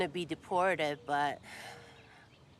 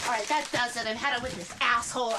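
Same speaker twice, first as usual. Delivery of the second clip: raised, higher angry voice; fed up; 5 semitones higher, 4 dB louder than usual. Acted. Woman done with a troublemaker, fed up. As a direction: explode with exasperation. Fed up, going to confront Roger.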